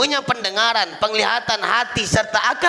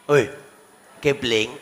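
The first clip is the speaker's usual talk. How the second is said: usual speaking voice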